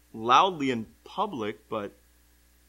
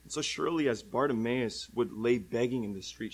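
ordinary talk

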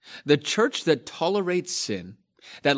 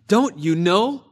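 higher, louder exasperated question; frustrated rebuke; 6 semitones higher, 5 dB louder than usual. Acted. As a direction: press them hard, frustrated. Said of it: "you can hear the frustration in the Apostle Paul's voice".

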